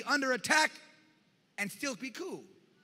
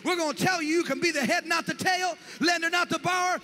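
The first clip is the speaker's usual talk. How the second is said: loud, higher rising sermon cadence; preaching at full force; 7 semitones higher, 7 dB louder than usual. Real, not acted. Preacher rousing the congregation about their future, at full force. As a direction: build the crowd to a peak.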